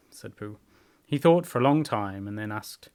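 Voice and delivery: ordinary delivery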